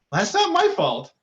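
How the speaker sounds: high, slow, incredulous protest; indignant retort. Retold or imitated. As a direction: act wronged and amazed. Retold indignant reply to client.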